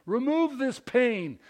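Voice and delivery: slow, high, loud appeal to God; forceful plea. Acted. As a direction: plead with strong force, stretching the words. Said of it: "to convey strong force".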